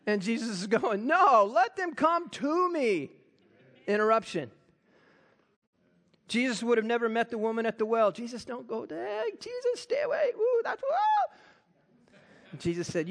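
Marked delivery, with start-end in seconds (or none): 1.0-3.1 high, drawn-out insistent call; emphatic overruling. Acted. Jesus stops disciples shooing children, emphatic acted call. 8.1-12.6 squealing falsetto alarm, drawn out at the end; fussing disciples. Acted. Disciples warn Jesus off the well, mock falsetto fretting.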